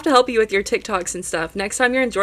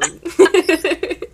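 giggly laugh; 9 semitones higher, 4 dB louder than usual. Real, not acted. Host laughs, ending the praise.

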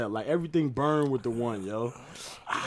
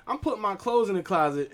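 normal talking voice

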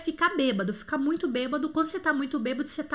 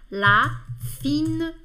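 la fine bouche drawn out very slowly; slow demo; much slower than usual. Shown as a demonstration. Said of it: "Então é o faire". (la fine bouche bem devagar)

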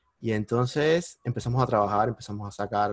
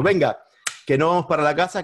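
higher, louder, bursting with joy; super happy; 5 semitones higher, 5 dB louder than usual. Retold or imitated. Son thrilled by a sale, tells his parents with excited glee. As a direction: announce it like great news. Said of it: "súper contento".

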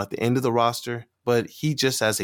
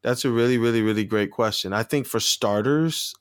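ordinary talk, nothing marked

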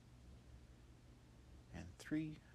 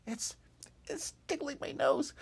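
high, louder giggly talk; tickled giggly voice; 12 dB louder than usual. Acted. Ruff telling Hop the vacuum tickles his nose. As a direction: squeak it out mid-giggle. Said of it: "And he's like".

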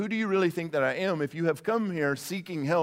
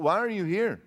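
ordinary delivery

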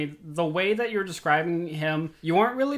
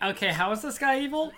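mock-disinterested question in a higher voice; 7 semitones higher than usual. Podcaster replaying their own clip question, disinterested. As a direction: imitate your own uninterested questioning. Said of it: "I sounded so, like, disinterested".

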